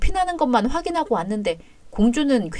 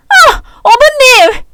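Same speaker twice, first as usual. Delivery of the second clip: anguished cry; 13 semitones higher, 10 dB louder, much slower than usual. Acted. Daughter-in-law crying out.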